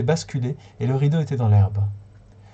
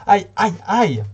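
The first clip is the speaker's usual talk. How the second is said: high, loud wailing aïe, aïe, aïe; shrill cry; 6 semitones higher, 5 dB louder, much slower than usual. A narrator's reading. Fanny cries out while stamping her feet. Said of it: "en criant".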